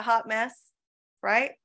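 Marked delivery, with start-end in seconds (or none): none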